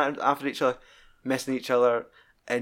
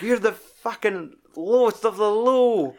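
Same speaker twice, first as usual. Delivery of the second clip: higher, louder, slower mock scorn; mock scornful insult; 8 semitones higher, 4 dB louder, slower than usual. Jack telling off a rival, mock scorn. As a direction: drag out the insult with hammed-up contempt.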